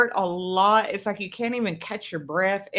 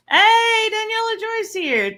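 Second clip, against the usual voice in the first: cheery greeting voice; 5 dB louder, slower than usual. Retold or imitated. Voicing a caller's birthday greeting.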